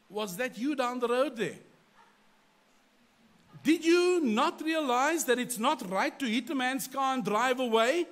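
loud, high, heated scolding; angry accusation. Retold or imitated. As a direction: rebuke him for the hit-and-run. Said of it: "That was my tone".

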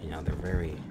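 whisper. Real, not acted. Player musing to himself during cutscene, soft whisper. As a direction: drop to a whisper mid-thought.

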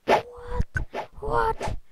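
high, startled double what; shocked squeak. Real, not acted. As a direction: squeal it in disbelief. Shocked by game result.

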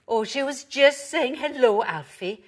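high, loud, reassuring dog character; lively storybook reassurance; Pepper. A narrator's reading. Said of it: "barked Pepper".

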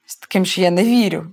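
usual speaking voice

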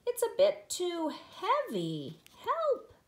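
slow strained words, jumping high on "Help"; straining grunt. Acted. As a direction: struggle under a heavy load. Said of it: "grunted Douglas".

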